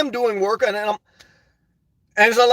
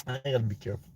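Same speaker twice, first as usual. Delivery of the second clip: muttered under his breath, much softer; half to himself, far quieter; much softer aside; 11 semitones lower, 14 dB quieter, faster than usual. Real, not acted. Vlogger warning himself in a much softer aside.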